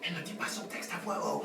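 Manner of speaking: quick unvoiced whisper; hurried whisper aside; fast whisper. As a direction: rush it under your breath. Aside during a silent take.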